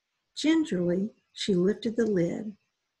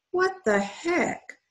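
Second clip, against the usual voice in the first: higher, slow shocked outburst; shocked bellow; 9 semitones higher, slower than usual. A narrator's reading. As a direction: bellow it in disbelief. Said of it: "Williams bellowed with shock".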